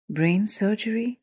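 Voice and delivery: a mother's tearful, slowed question. A narrator's reading. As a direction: let the question catch on tears. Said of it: "asked with tears glistening in her eyes".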